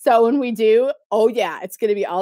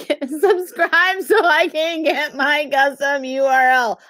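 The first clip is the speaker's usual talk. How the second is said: drawn-out, louder fake-crying beg; mock crying plea; 5 semitones higher, 4 dB louder, slower than usual. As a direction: beg and pretend to cry, stretching the words. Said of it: "keep begging and crying".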